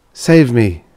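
loud cry for rescue; pleading. A narrator's reading. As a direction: beg to be saved. Voice crying out to be rescued.

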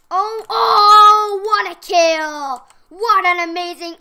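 loud, thrilled yell over a kill; excited shout. Real, not acted.

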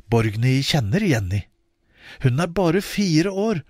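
ordinary delivery, nothing marked

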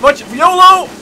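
high, loud reckless whoop; excited yell. Real, not acted. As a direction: yell it like a daredevil. Launching reckless attack.

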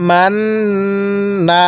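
মান্না with the ন্ন held long as a nasal hum; slow মান্না, humming the ন্ন through the nose; nasal ghunnah. Shown as a demonstration. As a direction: let the ন্ন of মান্না ring in the nose before the last না. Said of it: "নাকের বাসি দ্বারা গুনাহ হবে".

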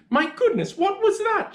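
high-pitched startled mother; startled cry. A narrator's reading. Mother hearing an awful noise from the tummy, startled cry. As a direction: exclaim in shock, pitch leaping up. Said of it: "she cried".

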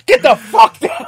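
high, loud and slow shouted order; mock shout. Retelling the robbery, shouting the command. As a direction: yell it like a robber bursting in.

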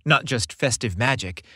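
plain reading voice

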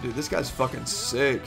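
normal talking voice

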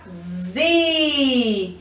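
ZI with a long, held I; teacher sounding out a long "zi"; syllable sound demo. Shown as a demonstration. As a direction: buzz the Z, then stretch the I in "zi". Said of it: "com a sílaba".

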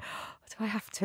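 a light imitation of a reluctant boyfriend; reluctant partner. Partner asked to read in.

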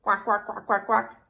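duck quacking. Shown as a demonstration. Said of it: "Can you quack like a duck?".

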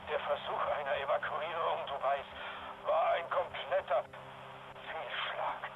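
distorted radio transmission; like a crackling field radio; crackly military radio. Acted. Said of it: "fingen wir diesen Funkspruch auf".

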